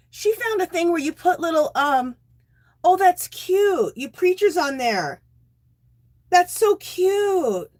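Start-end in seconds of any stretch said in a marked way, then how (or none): 2.8-7.7 cooing. Real, not acted. Admiring viewer's craft.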